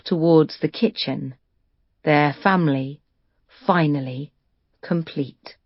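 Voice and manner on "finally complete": ordinary delivery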